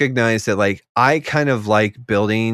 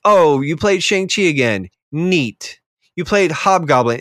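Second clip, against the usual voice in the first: sarcastic mock praise; 7 semitones higher than usual. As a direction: fake enthusiasm at a predictable play. Mock-bored quote to opponents.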